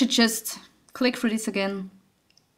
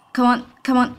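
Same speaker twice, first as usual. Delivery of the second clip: normal talking voice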